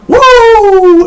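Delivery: a high, loud Ric Flair woo; high woo yell. Retold or imitated. Imitating Charlotte's woo cry to the crowd, loud and high. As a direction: belt out a shrill woo. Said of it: "Charlotte's signature woo".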